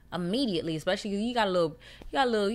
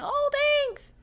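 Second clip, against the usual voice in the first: sweet thank-you; 7 dB louder than usual. Retold or imitated. Her own reply, acted.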